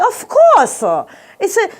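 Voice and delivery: high, loud mock-accent explanation; Chinese gentleman. Gentleman enlightens the storyteller about rats, high mock accent. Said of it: "He goes like".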